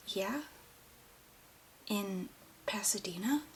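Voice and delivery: hushed, unvoiced whisper; frightened whisper to mother. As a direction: whisper it, barely any voice. Son frightened by mother's reaction.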